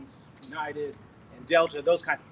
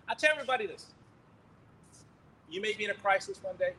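ordinary talk

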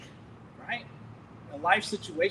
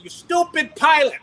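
high, loud, slow yell; furious shout; 9 semitones higher, 10 dB louder than usual. Retold or imitated. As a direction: bellow it at the pilot in anger. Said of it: "I'm sorry for any language I used".

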